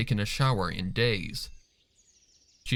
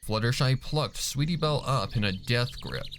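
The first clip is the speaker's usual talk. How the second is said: ordinary delivery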